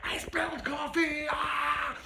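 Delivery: high, loud, stretched-out wail; distraught scream. Retold or imitated. Passenger to driver after spilling coffee, wailing scream. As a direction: shriek it in mock despair as the passenger.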